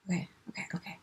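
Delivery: hushed whisper to herself. Real, not acted. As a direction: whisper to calm down. Calming herself down.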